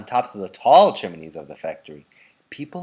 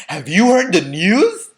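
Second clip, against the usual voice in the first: loud, higher eager father; excited cry; 8 semitones higher, 10 dB louder than usual. A narrator's reading. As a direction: burst in with big news. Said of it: "he cried".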